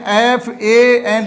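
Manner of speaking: एफ ए एन spelled out slowly; slow spelling demo. Shown as a demonstration. Teacher to class, slowly spelling एफ ए एन. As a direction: spell एफ ए एन letter by letter. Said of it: "दो अक्षर वाला फैन".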